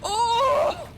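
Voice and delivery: high cry tipping into laughter; dismayed wail. Real, not acted. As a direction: cry out in defeat, half laughing. Losing at the finish.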